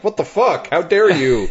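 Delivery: high, mock-indignant outburst; outraged Bill Donahue. Imitating a critic's reaction to a pastor, mock outrage. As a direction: act affronted, pitched well up. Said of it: "even Bill Donahue was like".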